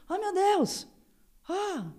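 high-pitched mock panic; acted dismay. Victim realising what is happening, high acted dismay. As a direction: exclaim it high, as the danger sinks in.

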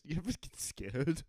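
a mild attempt at a scary voice; half-hearted spooky voice. Shown as a demonstration. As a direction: try for spooky, barely lands. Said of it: "I meant to say it in a scary voice".

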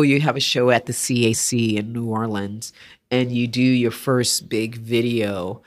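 normal talking voice

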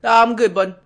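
loud, high, breezy brush-off; casual brush-off. Retold or imitated. Player brushes off the front desk guy, breezy and loud. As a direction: wave him off casually.

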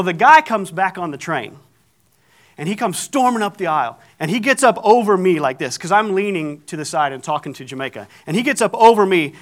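usual speaking voice

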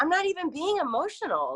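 high, exasperated denial; exasperated retort. Retold or imitated. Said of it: "I'm like".

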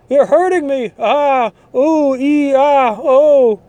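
high, loud hammed-up whining and yelps; mock fake victim. Arrested preacher whining at police, hammed-up high wailing. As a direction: play the arrested preacher crying persecution. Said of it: "another actor".

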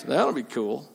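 ordinary talk, nothing marked

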